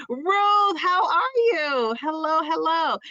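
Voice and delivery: high, slow, drawn-out hello; excited greeting. Real, not acted. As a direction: sing the name out happily.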